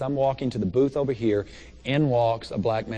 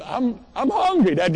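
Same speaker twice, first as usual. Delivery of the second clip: mock whiny complaint; 12 semitones higher, 6 dB louder than usual. Joking to the crowd about his empty stomach, mock whine. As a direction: whine it like a starving kid.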